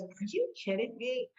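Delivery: lightly voiced skeptic in a changed voice; scoffing are you kidding me in a slightly different timbre; doubting inner voice. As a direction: mimic the nagging inner doubter. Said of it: "The voice that says".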